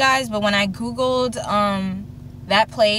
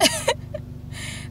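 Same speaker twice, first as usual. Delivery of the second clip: short high laugh; 8 dB quieter than usual. Real, not acted. Laughing at her doubts.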